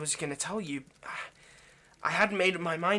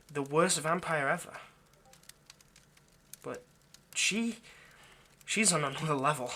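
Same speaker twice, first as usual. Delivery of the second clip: normal talking voice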